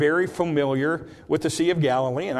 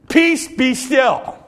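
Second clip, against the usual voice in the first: higher, louder, slow; loud command; 9 semitones higher, 6 dB louder, slower than usual. Shown as a demonstration. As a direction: shout it over the wind. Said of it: "he said it loudly for all the disciples to hear".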